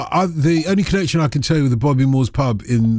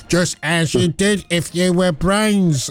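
ordinary talk, nothing marked

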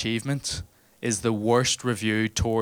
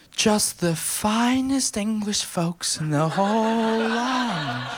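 slow, much higher posh English toff; 11 semitones higher, much slower than usual. Retold or imitated. English visitors asking Belfast shipbuilders, mock posh English. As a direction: drawl it like a pompous English gent. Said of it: "English people ... were like".

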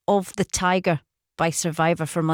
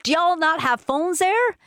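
put-on Virginian twang, pitched up high; Virginian accent; 8 semitones higher than usual. Shown as a demonstration. Presenter repeats the listener's message for co-host, Virginian accent. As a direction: have another go at a Virginian accent, lifting the question. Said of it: "What's your best Virginian accent?".

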